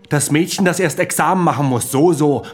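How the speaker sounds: mocking taunt.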